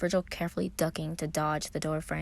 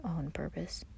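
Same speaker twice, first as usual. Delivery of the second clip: much softer, under the breath; mumbled; 10 dB quieter, slower than usual. A narrator's reading. Virgil grumbling at Patton, mumbled softly. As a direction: mutter it to yourself. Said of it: "Virgil mumbled".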